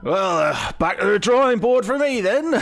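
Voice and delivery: high, rueful halfling voice; crashed halfling inventor. Acted. Halfling after his crash.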